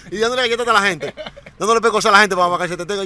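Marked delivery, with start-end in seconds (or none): none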